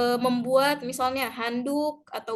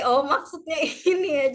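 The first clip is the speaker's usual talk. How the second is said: higher, laughing "oh, I get it"; amused realisation; 4 semitones higher, 3 dB louder than usual. Retold or imitated. Student finally understanding the lecturer's word, laughing realisation. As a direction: act out the penny dropping, with a laugh. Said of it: "baru saya akhirnya ngerti".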